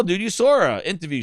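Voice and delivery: usual speaking voice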